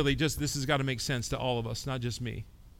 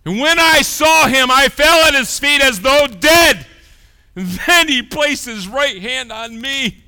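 impassioned sermon reading; full-force preaching; 11 semitones higher, 14 dB louder than usual. Acted. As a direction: proclaim the verse. Preaching the verse.

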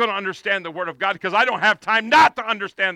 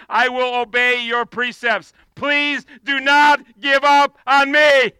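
pleading verse; 3 semitones higher, 7 dB louder, slower than usual. Acted. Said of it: "Look what he says".

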